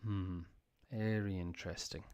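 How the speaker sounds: soft, flat, unimpressed boss; dismissive murmur. Retold or imitated. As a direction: brush it off quietly. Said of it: "he says".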